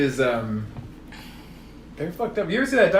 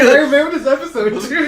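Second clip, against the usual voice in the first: mock excitement; 12 semitones higher, 8 dB louder than usual. Acting out what she said.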